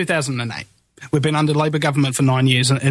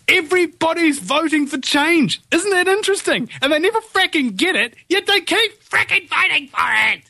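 high-pitched, worked-up exasperation; fed-up, high-voiced ranting; exasperated rant; 11 semitones higher than usual. Real, not acted. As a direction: let frustration push the voice right up. Ranting about voters, refuses calm.

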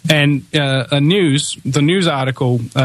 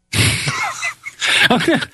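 short high laugh at his own bit. Real, not acted. Host to listeners, a short amused laugh. After acting the movie scene.

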